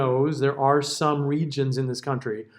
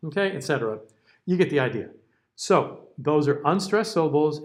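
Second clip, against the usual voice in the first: usual speaking voice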